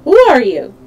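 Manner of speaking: calling out loudly. A narrator's reading. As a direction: call out a challenge to a group. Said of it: "called out in a loud voice".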